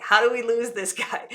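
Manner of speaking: half-laughed joking whisper-aside; laughing. Retold or imitated. Son joking about the overhelpful clerk, laughing.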